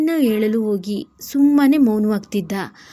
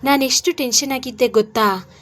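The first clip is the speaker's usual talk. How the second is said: ordinary delivery, nothing marked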